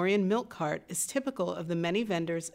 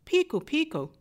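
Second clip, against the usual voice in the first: high-pitched sailor's call on sighting land; restrained narrator's version of an excited yell; lookout's yell; 9 semitones higher than usual. A narrator's reading. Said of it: "the lookout sees land and yells".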